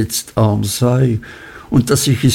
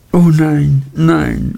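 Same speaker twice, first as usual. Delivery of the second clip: a touch of a sob, mildly acted; sobbing lightly; 5 semitones higher, slower than usual. A narrator's reading. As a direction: Dora half-sobbing her denial. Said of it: "schluchzte Dora".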